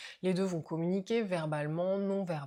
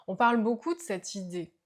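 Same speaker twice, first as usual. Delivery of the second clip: usual speaking voice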